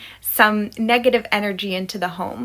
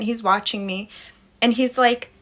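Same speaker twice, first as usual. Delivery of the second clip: plain reading voice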